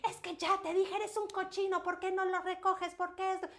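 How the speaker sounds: high, scolding parent; mock-scold a messy child, pitch up; nagging parent yelling. Shown as a demonstration. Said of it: "le estoy gritando".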